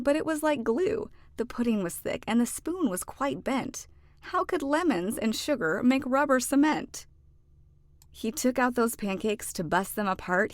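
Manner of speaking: ordinary delivery, nothing marked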